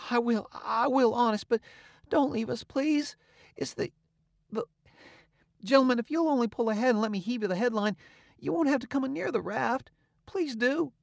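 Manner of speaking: put-on high, pleading boy near tears; desperate begging. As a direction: beg them not to go. Huck begs men not to leave.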